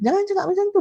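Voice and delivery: normal talking voice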